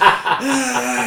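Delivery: high-pitched mock "aah" from an angry crowd; imitated crowd scream. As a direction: mimic a whole crowd screaming. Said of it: "everybody's just screaming every four-letter word".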